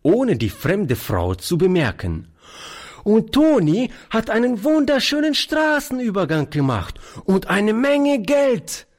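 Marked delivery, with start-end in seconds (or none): 3.0-8.9 put-on high, thrilled child voice; excited small child, pitched way up; delighted little girl. A narrator's reading. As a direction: boast breathlessly of wonderful news in a child's pitch. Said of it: "rief die Kleine voll Entzücken".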